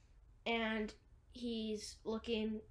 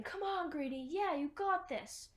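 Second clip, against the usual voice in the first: imitated cheering; 6 semitones higher than usual. Onlookers urge Greenie on before the fight, mock cheering. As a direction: lightly mimic a crowd egging someone on.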